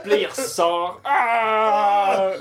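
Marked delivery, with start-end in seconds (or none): 1.0-2.3 dramatic shout. Acted. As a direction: wake up yelling theatrically. Lévesque waking, acted cry.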